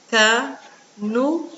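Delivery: "Canudo" as ca... nu... do, slowly with pauses; syllable by syllable. Shown as a demonstration. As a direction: split "Canudo" into three slow beats. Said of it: "Vamos separar?". ("Canudo" devagar, separado: ca, nu, do)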